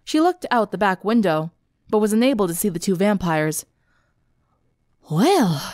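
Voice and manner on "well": falling, disgruntled sigh of a word. A narrator's reading. Applejack after the escape, disgruntled. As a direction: let it drop, mildly put out. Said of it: "Applejack said disgruntled".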